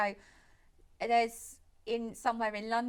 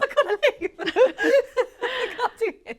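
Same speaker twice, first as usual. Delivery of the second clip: high, loud words through laughter; helpless laughing; 12 semitones higher, 9 dB louder than usual. Real, not acted. Co-host losing it during a sad story, laughing helplessly. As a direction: laugh so hard the words drag.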